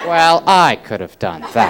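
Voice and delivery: normal talking voice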